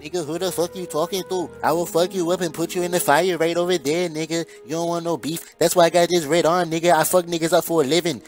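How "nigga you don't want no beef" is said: normal talking voice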